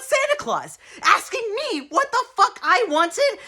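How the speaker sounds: high, loud, incredulous outburst; outraged disbelief. Acted. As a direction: cry out in hysterical indignation. Outraged at Santa's screaming.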